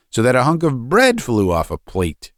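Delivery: ordinary delivery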